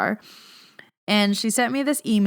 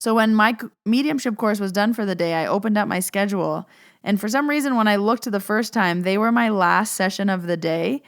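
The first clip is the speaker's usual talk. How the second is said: ordinary talk, nothing marked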